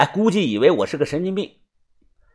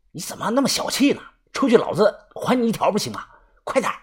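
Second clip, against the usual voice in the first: higher, hushed, rapid urging; impatient urging; 5 semitones higher, 4 dB quieter, faster than usual. A narrator's reading. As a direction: press him urgently in a lowered voice. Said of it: "他急了，瞪着眼说".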